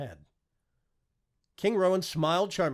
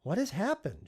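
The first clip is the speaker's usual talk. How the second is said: alarmed question; 8 semitones higher than usual. Acted. Wife shocked at scene.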